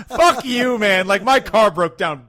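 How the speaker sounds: loud, high comic indignation; exasperated joking rant; mock outrage. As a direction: rail at spoiled celebrities for laughs. Mock outrage at trivial celebrity problem.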